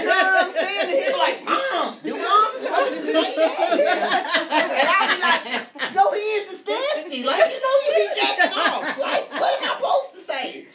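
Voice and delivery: high, loud laughing retelling. Real, not acted. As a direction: laugh the words out, barely holding it together. Laughing while retelling son exchange.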